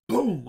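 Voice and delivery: loud, sudden vocal boom; imitated bang. Telling of bangs on a wall, imitated boom. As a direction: punch it out like a thud.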